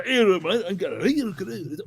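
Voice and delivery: slurred-together imitation Scottish mumbling; rambling Scottish accent. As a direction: ramble like an incomprehensible Scotsman. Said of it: "rambling on in his Scottish accent".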